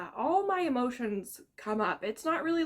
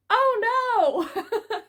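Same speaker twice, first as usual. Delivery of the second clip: laughing; 10 semitones higher, 7 dB louder than usual. Real, not acted. Laughs at the reveal.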